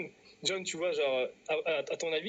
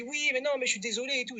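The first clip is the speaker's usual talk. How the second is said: high, imitated flustered excuse; mock pleading apology; 8 semitones higher than usual. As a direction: plead sorry in someone else's higher voice. Mime des excuses embarrassées. (excuses suppliantes imitées, voix aiguë)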